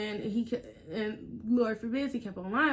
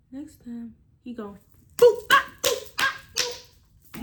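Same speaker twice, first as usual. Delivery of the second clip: mock punches; 3 semitones higher, 8 dB louder, slower than usual. Imitating hits landing.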